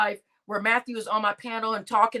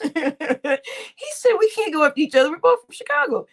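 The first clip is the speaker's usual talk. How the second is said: laughing; 4 semitones higher, 4 dB louder than usual. Real, not acted. Laughing while reading a comment.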